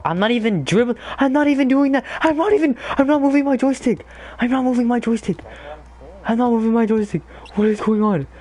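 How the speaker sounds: high, fast exasperated protest; frustrated protest. Real, not acted. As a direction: insist it isn't your fault. Frustrated gamer protesting the controls.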